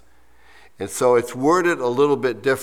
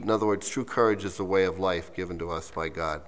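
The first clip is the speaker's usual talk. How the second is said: ordinary talk, nothing marked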